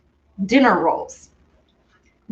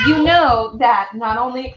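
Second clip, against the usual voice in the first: normal talking voice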